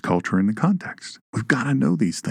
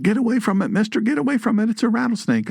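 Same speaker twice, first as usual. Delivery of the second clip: much higher, panicked pleading to get away; frightened warning; 11 semitones higher, faster than usual. Retold or imitated. Frightened neighbour warning the man off the snake, panicky and high. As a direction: play the scared neighbour shrieking a warning. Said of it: "And they said".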